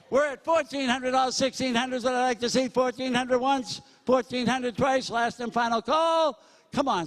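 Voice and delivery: brisk, punchy auctioneer chant, pitch up; auctioneer bid calling. Acted. Auctioneer working the crowd at a school charity, brisk bid calling. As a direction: sell it to the room, count the bids out.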